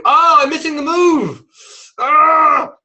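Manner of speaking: high, loud exasperated cry; frustrated trader. Retold or imitated. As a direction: act out the trader who just missed the move, yelling. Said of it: "And Kim gets upset".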